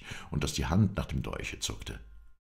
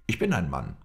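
raised, louder, indignant protest; angry; 5 semitones higher, 6 dB louder than usual. A narrator's reading. Young warrior answers the insult, angry. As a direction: insist on it hotly. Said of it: "rief er zornig".

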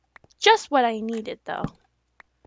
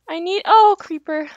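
ordinary talk

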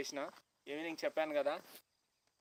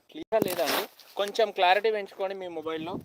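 loud, raised voice; shouting; 4 semitones higher, 9 dB louder than usual. Real, not acted. Vlogger shouting phone tips to viewers without a mic. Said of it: "గట్టిగా అరుస్తున్నా".

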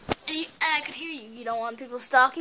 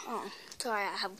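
whispering; 5 semitones lower, 10 dB quieter, much faster than usual. Real, not acted. Quick aside, gum noticed.

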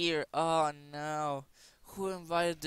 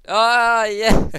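excited yell; 7 semitones higher, 15 dB louder than usual. Real, not acted. Excited outburst.

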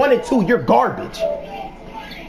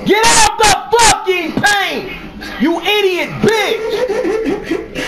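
furious shout; 7 semitones higher, slower than usual. Real, not acted. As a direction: scream it at him in real anger. Screaming at teammate in paint.

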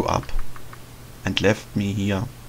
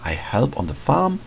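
softer, quieter inner voice reading a diary; diary narration; 3 semitones lower, 4 dB quieter than usual. Acted. The boy reads his diary entry quietly to himself. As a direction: read it like a private journal entry.

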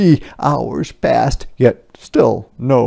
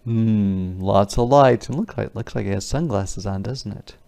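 usual speaking voice

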